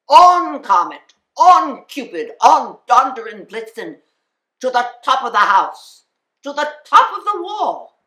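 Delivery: loud, ringing calls to the reindeer; shouted calling. Acted. Santa calling his reindeer by name, shouting loudly. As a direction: call each name out across the rooftops. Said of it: "he whistled and shouted and called them".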